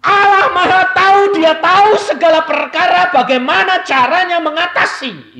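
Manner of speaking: high, loud proclaiming; fiery preaching. Acted. Preacher proclaiming God's knowledge, loud fiery preaching. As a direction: proclaim it to the whole hall.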